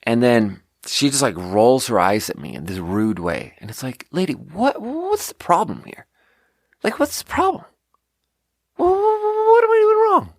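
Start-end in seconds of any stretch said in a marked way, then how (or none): 4.1-10.3 high, squeaky, incredulous retort climbing ever higher; exasperated mock squeak. Bartender mocking her own disbelief at an eye-rolling customer, rising squeak. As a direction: play it as a baffled, mocking squeal.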